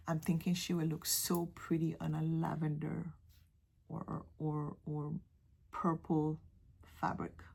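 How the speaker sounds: whispered musing to herself. Real, not acted. Picking fabric for the mermaid, whispered. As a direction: hush it, thinking aloud.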